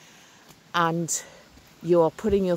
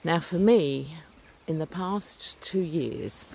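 ordinary talk

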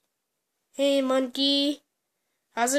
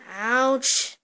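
usual speaking voice

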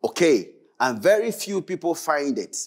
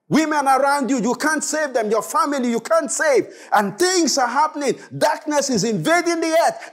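high, forceful sermon rebuke; full-force preaching; 8 semitones higher, 3 dB louder than usual. Acted. Preacher rebuking believers who can't save those around them, fervent and forceful. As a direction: preach it hard, pitched high and urgent.

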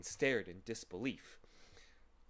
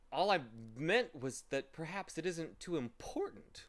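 higher-pitched, careful backpedalling; trying to be polite; 7 semitones higher, 3 dB louder than usual. A narrator's reading. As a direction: tread carefully so as not to offend. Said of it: "trying not to be impolite".

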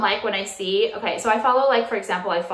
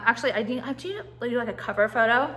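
usual speaking voice

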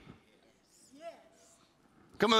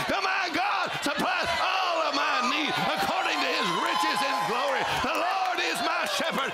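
preaching at full force; 7 semitones higher than usual. Acted. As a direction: proclaim each confession like a battle cry. Declaring confessions at full force.

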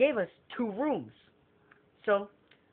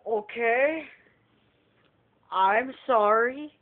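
a higher, drawn-out sheepish sorry; meek apology; 3 semitones higher, 5 dB louder, much slower than usual. Retold or imitated. Guest apologizing to the fake hotel staff, slow and meek. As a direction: stretch out the apology slowly. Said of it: "He's like".

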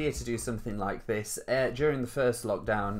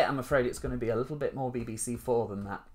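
ordinary talk, nothing marked

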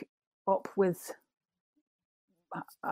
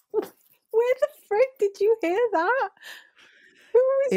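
squealing through laughter; laughing hard; 14 semitones higher, 3 dB louder, slower than usual. Real, not acted. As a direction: laugh through the words, high and breathless. Laughing at the accent.

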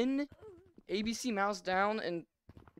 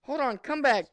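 calling out; 4 semitones higher, 7 dB louder than usual. Real, not acted. Calling a fleeing player back, a loud demand. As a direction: call him back like a scolding parent.

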